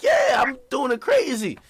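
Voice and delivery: high excited shout; hyped shout. Real, not acted. Host hyping his crazier video idea, excited shout. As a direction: yell it all hyped.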